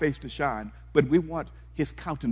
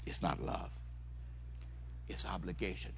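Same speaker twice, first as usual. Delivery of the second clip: dropped to a near whisper; hushed emphasis; 6 semitones lower, 12 dB quieter than usual. Acted. Preacher hushing the church on what love is not, near whisper. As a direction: pull them in with a hush.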